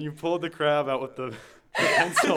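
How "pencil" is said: laughing through the word. Real, not acted. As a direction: burst out laughing mid-word. Laughing while retelling crab story.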